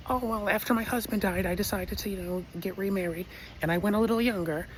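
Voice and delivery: a hint of a proud old neighbor; old lady neighbor.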